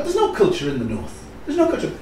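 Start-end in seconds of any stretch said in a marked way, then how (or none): 1.5-1.9 "no" pitched much higher in mock scorn; mock disbelief. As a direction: echo it back, higher and mocking. Mocking the southern view.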